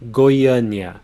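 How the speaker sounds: Goiânia said goy-AH-nee-ah, stress on the second syllable; Portuguese pronunciation. Shown as a demonstration. Teacher models the capital of Goiás for students, stressing ÂN. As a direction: say Goiânia with the weight on ÂN. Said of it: "break it down".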